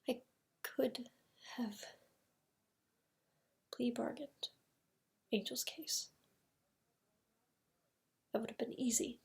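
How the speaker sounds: very soft, broken by long pauses; hushed admission. Acted. Lawyer weighing the plea bargain he refused, hushed. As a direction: let each phrase out quietly, haltingly.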